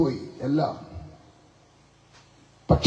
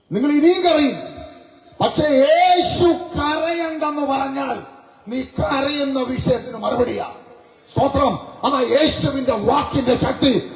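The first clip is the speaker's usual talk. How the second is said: preaching at full force; 8 semitones higher than usual. Acted. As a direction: ring it out over the congregation. Preacher proclaiming with a shout. (ഉയർന്ന ശബ്ദത്തിൽ പതുക്കെ ഘോഷിക്കുന്ന പ്രസംഗം)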